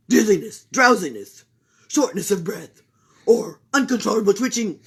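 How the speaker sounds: loud brisk fussy doctor; Doctor Bumble questioning Camilla. Acted. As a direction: rattle off symptoms. Doctor voice continues.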